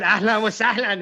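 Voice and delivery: "Ahlan wa sahlan" said as a native Arabic greeting. Retold or imitated. Walking into the bodega greeting staff, Arabic "Ahlan wa sahlan". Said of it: "I walked in and I said".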